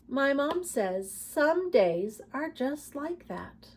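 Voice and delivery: put-on reading a picture book aloud to children; lively read-aloud with emphatic refrains; story narration. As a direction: tell it like a storybook for kids.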